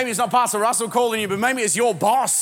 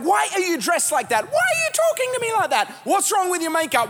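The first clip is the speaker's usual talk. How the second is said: higher, nagging accusations; mock argument; 8 semitones higher than usual. Husband mimics wife snapping in the car before church. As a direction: play the spouse picking a fight. Acted car quarrel, spouse voiced.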